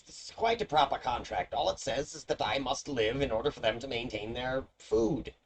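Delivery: high, indignant imp pleading his case; a put-out little imp defending his contract. Acted. Imp arguing with adventurers about his contract, high and indignant. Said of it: "He says".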